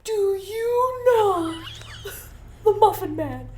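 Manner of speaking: gingerbread man impression. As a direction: stretch each word out like a tiny tortured cookie. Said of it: "I could do a really good gingerbread impression".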